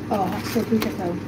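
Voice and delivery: normal talking voice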